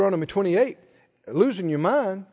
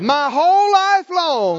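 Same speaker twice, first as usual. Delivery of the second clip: slow, raised-pitch proclamation; out loud, like you mean it; 7 semitones higher, 7 dB louder, slower than usual. Shown as a demonstration. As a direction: lead the congregation with drawn-out conviction. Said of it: "say it like you mean it".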